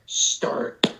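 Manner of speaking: soft, drawn-out unvoiced "start". Player urging host to start, soft start voice. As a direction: stretch it out quietly in a put-on voice. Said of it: "he's doing the start voice".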